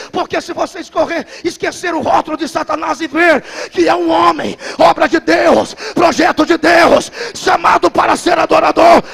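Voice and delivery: shouted, building preacher's cry; preaching at full force. Acted. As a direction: preach it out at the top of your voice.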